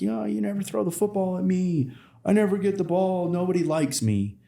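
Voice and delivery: put-on high-pitched, whimpering little boy; whiny hurt kid. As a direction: play the sulking kid who got left out of the game. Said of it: "Johnny's like".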